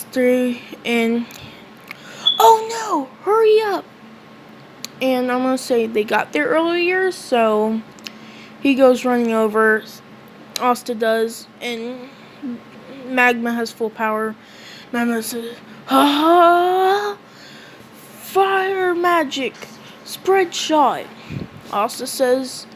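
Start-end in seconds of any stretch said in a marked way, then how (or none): 2.4-3.8 much higher, louder urgent call; alarmed cry. Acted. Alarmed urging. 15.9-21.0 hammy spell call. Acted. Magma casting spell.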